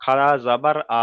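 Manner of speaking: slow, level spelling with a long আ; measured Quran-class drill; drawn-out recitation. Shown as a demonstration. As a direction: stretch the closing আ for one alif. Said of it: "এখানে টানটা এক আলিফ দিতে হবে".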